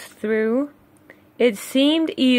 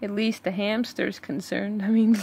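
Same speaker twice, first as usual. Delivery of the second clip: softer muttered comment; hushed aside; 8 dB quieter, faster than usual. Real, not acted. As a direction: say it under your breath, amused.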